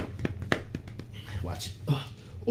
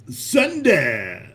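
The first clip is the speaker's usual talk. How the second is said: emphatic send it. Real, not acted. Urges April to send it, emphatic. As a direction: send it punched out with a fall.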